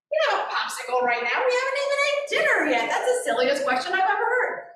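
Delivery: fast, rising mock-sensible parent reply; dismissive parent. Parent refusing child's popsicle, dismissive rising reply. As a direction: brush the child off with reason. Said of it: "Don't try to talk them out".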